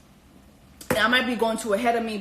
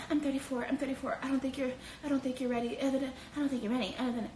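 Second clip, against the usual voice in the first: soft, quick, breathy-sounding flirting; mock soft flirt voice; 10 dB quieter, faster than usual. Jessica flirting with Mark, mock soft rushed voice. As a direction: murmur it coyly and fast. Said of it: "She would put on this fucking voice".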